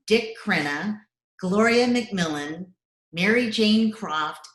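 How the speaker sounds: ordinary talk, nothing marked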